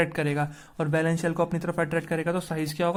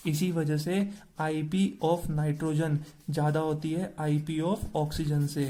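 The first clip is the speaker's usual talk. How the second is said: slow dictation; much slower than usual. Shown as a demonstration. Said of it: "teacher dictating answer for notes".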